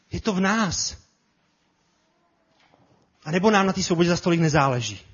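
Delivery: ordinary talk, nothing marked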